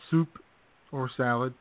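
ordinary delivery

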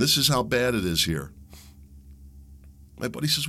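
usual speaking voice

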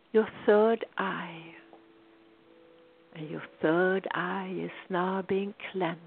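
ordinary delivery

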